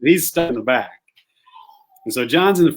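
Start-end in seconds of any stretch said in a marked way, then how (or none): none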